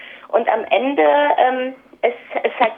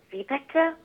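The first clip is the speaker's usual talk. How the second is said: mildly aghast, voice lifted and softened; a hushed note of dismay; understated shocked question; appalled; 4 semitones higher, 7 dB quieter than usual. A narrator's reading. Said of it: "Oma war entsetzt".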